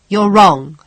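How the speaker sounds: loud protest; father cries out. Acted. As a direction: burst out against her words. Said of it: "her father cried".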